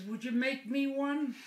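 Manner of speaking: high-pitched parrot asking a favour; pleading parrot. Acted. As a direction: wheedle up high as a pet bird. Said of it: "And the bird says".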